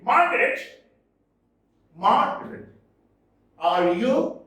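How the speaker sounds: MAR hit high and loud, then ARE; scanning the verse with loud stresses; stressed syllables marked. Shown as a demonstration. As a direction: punch the first syllable of Margaret and lean on ARE. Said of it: "The syllables in red are stressed".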